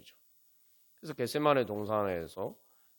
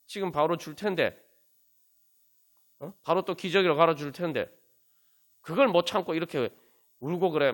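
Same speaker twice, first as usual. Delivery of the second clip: raised-pitch exasperated reproach; acted scolding; 5 semitones higher, 4 dB louder than usual. Parent to a baby awaiting a diaper, mock scolding. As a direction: scold lightly as an impatient parent would. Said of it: "책망합니까?".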